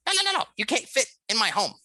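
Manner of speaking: high, loud, rapid-fire mouse protest; manic little mouse. Acted. Mouse stops the player going for mushrooms, manic. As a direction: object frantically in a tiny squeaky voice. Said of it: "almost manic voice, just really energetic".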